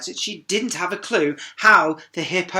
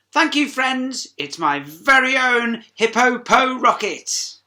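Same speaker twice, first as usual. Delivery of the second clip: higher, louder delighted thanks; proud hippo; 8 semitones higher, 7 dB louder than usual. Acted. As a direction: beam with pride at the rocket. Sheila thanks friends.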